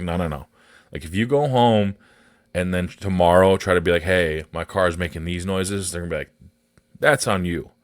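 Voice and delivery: usual speaking voice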